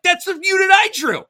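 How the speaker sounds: very high, loud astonished squeal; hammed-up thrilled yelp; excited astonishment. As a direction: shriek out the coincidence. Retold realization of same unit.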